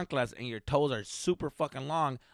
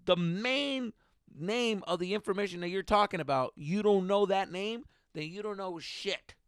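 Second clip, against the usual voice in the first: indignant retort; 6 semitones higher than usual. Acted. Telling off the man who forgot the chemical's name, indignant. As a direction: lay it down word by word, raised pitch. Acted retort he thought in head.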